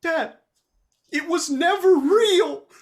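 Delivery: put-on high, pleading young hare son; a kid insisting his dad was fooled; son's voice. Son tells his father the carrot was never real, high and pleading. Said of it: "he goes".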